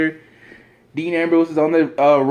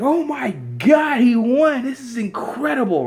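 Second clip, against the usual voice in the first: amazed crowd; 10 semitones higher than usual. Acted. Everyone reacting to the title win, amazed. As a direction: gush it high, stretching the first words.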